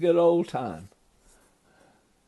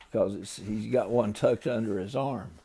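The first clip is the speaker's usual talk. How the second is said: plain reading voice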